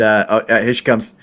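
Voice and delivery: ordinary talk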